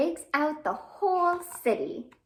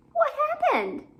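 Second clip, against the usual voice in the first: much higher, louder surprised question; excited question; 6 dB louder than usual. Acted. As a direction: ask it with big alarm. Asking the worm in the rhyme.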